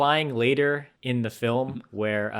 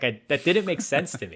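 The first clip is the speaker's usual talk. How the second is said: ordinary talk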